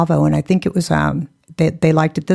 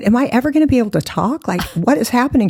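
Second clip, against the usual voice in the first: bewildered, fast; 6 semitones higher, faster than usual. Retold or imitated. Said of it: "I said".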